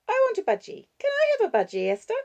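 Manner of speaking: put-on very high, loud little-girl voice; pleading child. Six-year-old asking grandmother for a budgie, high pleading child voice. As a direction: pipe up eagerly as a small child.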